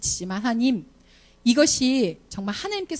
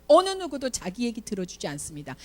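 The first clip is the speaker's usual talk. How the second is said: normal talking voice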